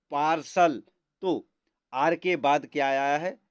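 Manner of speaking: normal talking voice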